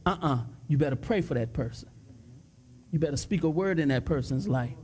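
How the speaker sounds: deep, steady voice giving a firm instruction; voice of God. Retold or imitated. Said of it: "God can say".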